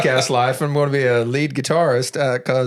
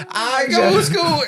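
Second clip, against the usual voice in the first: put-on Kentucky accent. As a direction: say it like a little Kentucky girl. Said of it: "she had kind of my mom's accent".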